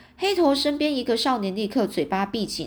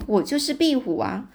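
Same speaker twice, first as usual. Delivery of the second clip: usual speaking voice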